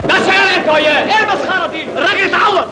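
loud, high-pitched scolding shout; shouting down a brawl. Acted. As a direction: yell over the commotion to stop them. Breaking up a brawl, man hurt.

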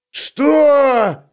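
loud, high outraged roar. Acted. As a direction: bellow in disbelief. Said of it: "проревел Улли". (гнев: громкий рёв)

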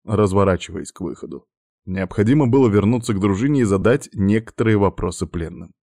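ordinary delivery

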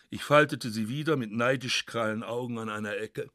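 usual speaking voice

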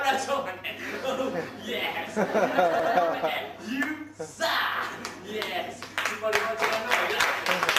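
loud, cackling sales pitch; loud laughing salesman. Acted. Security salesman signing up a client, loud laughing pitch. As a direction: laugh it up like a gleeful huckster.

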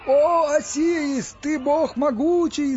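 high, loud, drawn-out exaltation; excited yell. A narrator's reading. Gopa hops round the resurrected man, yelling praise. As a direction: cry out in slow high ecstasy. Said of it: "заорал Гопа".

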